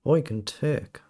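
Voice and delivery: ordinary talk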